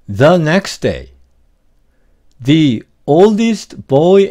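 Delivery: slow, careful word by word reading; over enunciating. Shown as a demonstration. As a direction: read each phrase slowly for learners. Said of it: "제가 한번 차근차근 읽어보겠습니다".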